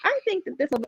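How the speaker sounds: very high, bright mother voice asking for approval; gushing mother voice. Retold or imitated. Mother asks daughter about dress, gushing and high. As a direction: mimic mom fussing over a dress. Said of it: "she was like".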